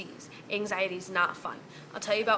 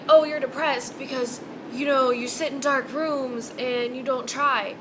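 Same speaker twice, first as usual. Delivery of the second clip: slow, sneering imitation of a dismissive person; mocking quote; 2 semitones higher, 5 dB louder than usual. Ranting vlogger mimics people dismissing depression, slow and mocking. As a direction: drag it out like you're mocking them.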